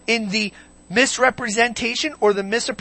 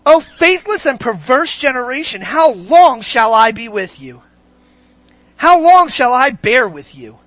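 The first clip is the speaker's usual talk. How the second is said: exasperated Jesus; 2 semitones higher, 8 dB louder than usual. Acted. Preacher re-reads the verse as Jesus said it, loud exasperated reproach. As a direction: cry it out as an impassioned reproach. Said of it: "Can I read that more like how I believe Jesus said it".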